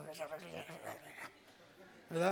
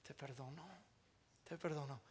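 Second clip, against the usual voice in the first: soft forgiveness; 6 semitones lower, 16 dB quieter, faster than usual. Retold or imitated. Wife grants forgiveness.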